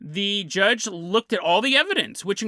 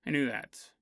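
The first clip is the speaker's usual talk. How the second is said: much lower and softer, fading away; sheepish trailing repeat; 9 semitones lower, 9 dB quieter than usual. Retold or imitated. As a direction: let it die off under your breath.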